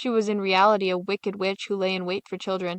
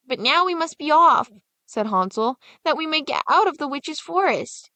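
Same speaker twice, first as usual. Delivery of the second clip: put-on higher, louder, hurried boy; urgent boy; 6 semitones higher, 3 dB louder than usual. Hansel urging his sister out of the forest, higher and louder. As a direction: press your sister to leave.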